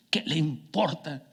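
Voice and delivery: slow, hushed imitation of an impassioned preacher; imitated emotion, soft and drawn-out; emotional voice. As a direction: draw it out softly, voicing someone deeply moved. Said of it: "voz así emocionada".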